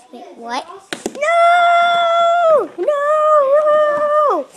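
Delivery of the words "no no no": high, loud terrified shrieks; panicked screams. Acted. Captured bird screaming at the pigs, high and loud. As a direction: scream as they grab you.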